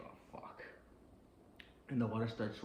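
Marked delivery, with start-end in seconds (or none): 0.1-0.6 breathless whisper, much softer; seeing his sandals float by, whispering; whispered dread. Retold or imitated. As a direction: whisper it under your breath in dismay. Chanclas floating, quiet dread.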